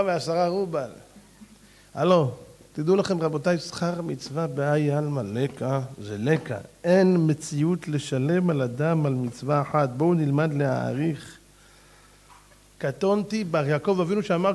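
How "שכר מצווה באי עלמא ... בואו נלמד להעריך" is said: slow, lowered quoting; slow solemn teaching. Real, not acted. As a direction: drive the lesson home slowly.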